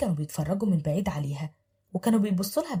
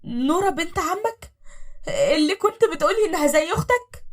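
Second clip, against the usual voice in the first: stunned disbelief; 9 semitones higher, 4 dB louder than usual. Acted. Wife asking in shock about her husband's cousin. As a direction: drag out the disbelief.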